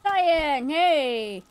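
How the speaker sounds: loud excited greeting; calling out. Real, not acted. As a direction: call out to a friend across the room. Greeting a friend joining stream.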